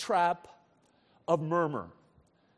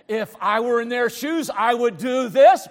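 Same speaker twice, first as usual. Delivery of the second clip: loud, raised-pitch griping; mock indignant complaint; 4 semitones higher, 10 dB louder than usual. Frustrated person complains about others, mock indignant. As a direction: grumble loudly in a hammed-up voice. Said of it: "But you're muttering".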